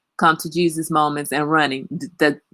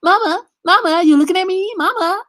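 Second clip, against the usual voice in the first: put-on higher, louder nagging kid voice; pestering child; 11 semitones higher, 6 dB louder than usual. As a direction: chant mama over and over like a needy toddler. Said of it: "be like".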